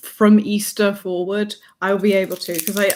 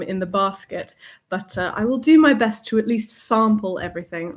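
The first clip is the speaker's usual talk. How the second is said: usual speaking voice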